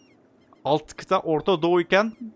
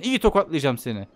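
usual speaking voice

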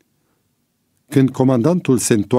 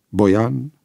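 plain reading voice

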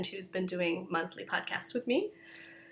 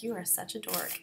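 self-mocking mutter; 2 semitones lower, 9 dB quieter than usual. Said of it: "I'm like".

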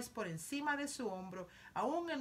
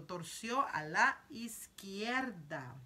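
ordinary delivery

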